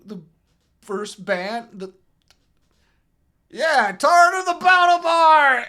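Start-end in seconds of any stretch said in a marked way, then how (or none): 3.5-5.7 put-on high, slow, loud drunken bellow; booming announcer yell. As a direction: announce the band at full volume. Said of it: "a booming voice, kinda drunkenly".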